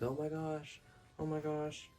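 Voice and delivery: a touch of fake alarm; mock panic. Vlogger voicing the girls seeing water turn to blood, mock alarmed. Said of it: "It's like".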